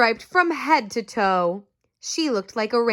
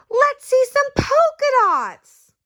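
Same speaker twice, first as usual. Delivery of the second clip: high, slow, loud playground call; kid yelling; 5 dB louder, slower than usual. Acted. Classmate calling out for polka dots, high loud yell. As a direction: shout it like a teasing kid. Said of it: "One yelled out".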